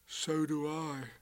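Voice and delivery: soft, slow and resigned; sad. A narrator's reading. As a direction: sigh it out glumly. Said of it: "And Pooh said sadly".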